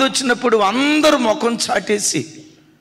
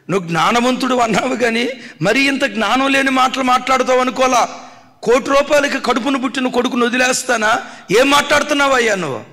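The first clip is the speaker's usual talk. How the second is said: higher and louder, outraged mother; indignant scolding; 5 semitones higher, 3 dB louder, faster than usual. Retold or imitated. Mother retorting to King Solomon, indignant raised voice. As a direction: rebuke him sharply, getting faster at the end.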